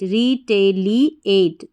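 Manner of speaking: "री टे ली एट" slowly, one syllable at a time. Shown as a demonstration. Teacher building retaliate from its spelling, slow syllable-by-syllable. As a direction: break it up slowly as "री", "टे", "ली", "एट". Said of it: "तो इस तरीके से".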